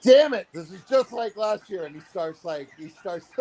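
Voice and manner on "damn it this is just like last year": loud, high mock exasperation; retold outburst, pitched up and punchy; worked up. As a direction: imitate a buddy getting worked up. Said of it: "like, worked up".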